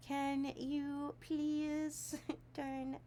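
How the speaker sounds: very slow, drawn-out mock plea broken by laughs; playful pleading. Asking her husband to turn down the heat, drawn-out begging. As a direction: stretch every word like begging. Reading a pleading message aloud.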